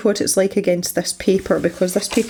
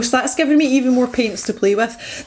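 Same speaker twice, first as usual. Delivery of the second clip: ordinary delivery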